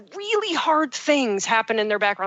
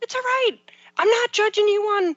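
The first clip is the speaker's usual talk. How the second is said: high, gentle reassurance; sweet, high it's-all-right voice; reassuring; 10 semitones higher than usual. Retold or imitated. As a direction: soothe them, pitch lifted high. Reassuring someone about a messy house.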